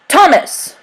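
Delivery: sharp call, high and loud; shout. A narrator's reading. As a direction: bark his name across the deck. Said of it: "Ratcliffe shouted".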